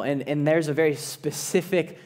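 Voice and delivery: ordinary talk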